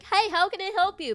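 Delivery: customer service voice. Shown as a demonstration. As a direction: pitch it up and be expressive for the caller. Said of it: "like, customer service".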